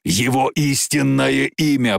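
slow, growled demand; drawn-out dragon's growl. Acted. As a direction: growl it out emphatically. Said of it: "прорычал Меланхтон".

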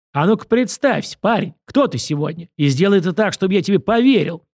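lightly voiced bossy director putting someone to the test; challenging order. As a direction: push it as a challenge. Director challenges newcomer to introduce himself.

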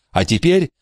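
high, firm command. Acted. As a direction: order them firmly to close their eyes. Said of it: "велела Кейт".

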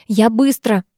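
usual speaking voice

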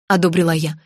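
plain reading voice

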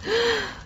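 sharp startled gasp; a sudden intake of breath in shock. Acted. Manuela gasps at Jose's threat, a sharp shocked gasp.

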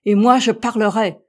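loud defiant retort; American defying the captain, loud. Acted. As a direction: refuse to back down. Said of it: "riposta l'Américain".

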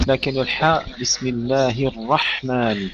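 ordinary talk, nothing marked

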